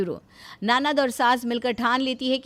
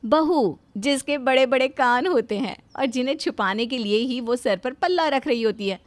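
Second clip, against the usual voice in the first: usual speaking voice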